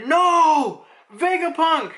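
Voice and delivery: high, loud drawn-out mock scream; acted horrified cry. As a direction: cry out in hammed-up horror. Acting horrified cry for Vegapunk.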